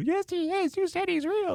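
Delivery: put-on high, upset little-girl voice; a small child insisting through tears. Terrified niece quoted.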